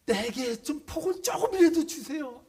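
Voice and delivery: high, loud, wailing plea; Esau's high, loud, sobbing plea to his father. Shown as a demonstration. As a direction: beg for a blessing through tears. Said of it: "막 울부짖었다는 거예요".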